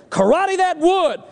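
high, drawn-out command; mock martial-arts order to a dog. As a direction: call out the order high and slow. Clerk commands the poodle.

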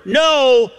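NO high, loud and held; emphatic no. Retold or imitated. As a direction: hit NO as an absolute verdict. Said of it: "same word".

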